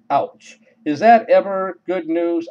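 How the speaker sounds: plain reading voice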